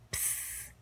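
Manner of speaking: a soft unvoiced psst to get a friend's attention; hushed psst between classmates; whispered psst. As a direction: breathe it out so the sleeping teacher doesn't wake. Secret call while teacher sleeps.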